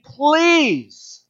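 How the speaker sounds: high, loud, stretched-out please; agonized please. Retold or imitated. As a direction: stretch out the word please in anguish. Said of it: "said with such agony".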